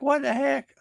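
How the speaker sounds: high-pitched disbelieving what the heck; shocked outburst. Retold or imitated. Seeing the surprise charge on his bank statement, high shocked outburst. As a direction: squeak it out in disbelief. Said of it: "And I'm like".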